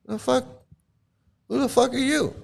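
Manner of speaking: high, scornful mock outrage; indignant guru. Guru scoffing at a nosy devotee, high mock outrage. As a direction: toss the question back in scorn. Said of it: "I like when Prabhupada says".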